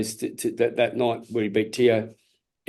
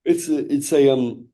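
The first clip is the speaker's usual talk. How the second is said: talking through a yawn; words stretched by a yawn; 3 semitones higher than usual. Real, not acted. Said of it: "I'm yawning, Taz".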